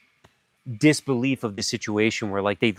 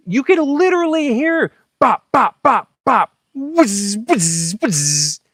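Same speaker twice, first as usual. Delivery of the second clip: high loud pops then whizzing; imitated gunfire; 12 semitones higher, 5 dB louder, much slower than usual. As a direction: mimic bullets flying past. Reliving gunfire sounds.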